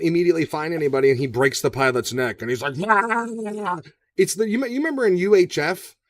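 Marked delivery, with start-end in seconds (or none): 2.9-3.8 long, higher-pitched mock scream; drawn-out yell imitating an action-movie actor; imitated movie scream. Podcaster imitating Batista screaming at the pilot, mock scream. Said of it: "he screams at him".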